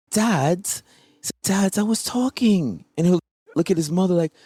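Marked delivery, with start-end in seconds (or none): 0.1-0.7 put-on much higher, a small boy calling Dad; child voice. Voicing his four-year-old son. 1.4-2.8 put-on much higher, a small boy calling Dad; child voice. Voicing his four-year-old son.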